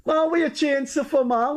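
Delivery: high, coaxing mock complaint; imitating a fan's disappointed plea; pleading reproach. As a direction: plead high, half-scolding a friend. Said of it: "he said".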